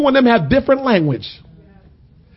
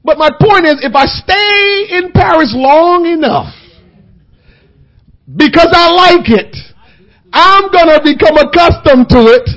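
louder, higher preaching drive; full-force preaching; 7 semitones higher, 7 dB louder than usual. Acted. Preacher driving home his Paris lesson, full-force preaching. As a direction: build toward the punchline.

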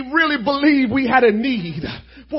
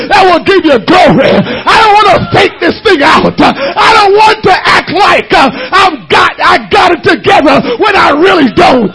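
high, loud preacher's plea, crying out; full-force preaching; 6 semitones higher, 6 dB louder than usual. Acted. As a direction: call out each need to God with force.

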